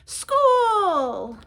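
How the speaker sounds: high, loud joyful shout; excited cry. A narrator's reading. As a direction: call out with delight. Child cheering about school.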